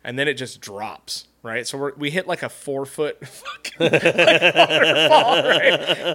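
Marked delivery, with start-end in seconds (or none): 3.2-6.1 high, cracking up mid-story; helpless laugh stretching the words; laughing while talking. Real, not acted. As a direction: let real laughter break through the words. Laughs while telling the waterfall drop.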